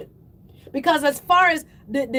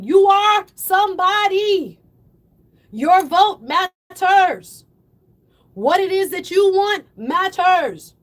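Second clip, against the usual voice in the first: slow, raised, drawn-out emphasis; rousing exhortation; 3 semitones higher, 4 dB louder, slower than usual. Real, not acted. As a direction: preach it to lift the listener up.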